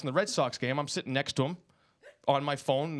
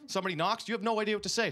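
usual speaking voice

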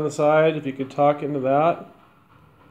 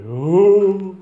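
loud, high spooky ghost moan; demonic moan; 5 semitones higher, 6 dB louder than usual. Retold or imitated. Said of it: "a demonic sound to it".

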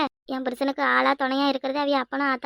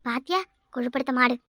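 ordinary delivery

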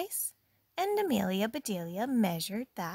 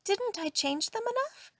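eager Amelia voice; 13 semitones higher than usual. Acted. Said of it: "asked Amelia Bedelia".

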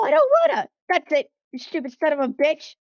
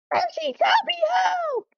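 much higher, frantic yell; cornered engine yelling to Percy for help; shouted cry for help; 4 dB louder, slower than usual. Acted. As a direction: scream for Percy to save you. Calling a friend for help.

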